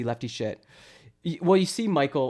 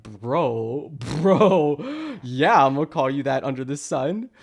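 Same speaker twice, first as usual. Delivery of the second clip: usual speaking voice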